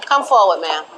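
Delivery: ordinary talk, nothing marked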